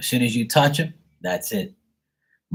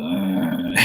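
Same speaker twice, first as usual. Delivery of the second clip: imitated dog growl; 2 semitones lower than usual. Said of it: "And she'll go".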